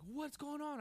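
high, startled call from the kitchen; alarmed call. Retold or imitated. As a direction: call out worried to the hurt child. Father runs to crying child.

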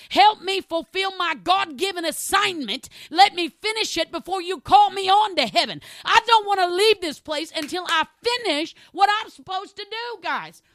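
high, loud, climbing preacher's cry; preaching at full force. Real, not acted. Preacher rousing the church to finish their assignment, full-throated shout.

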